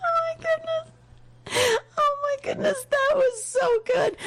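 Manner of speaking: high squeals through laughter; gasping out words between laughs; laughing while talking. Real, not acted. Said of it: "my side hurts from laughing".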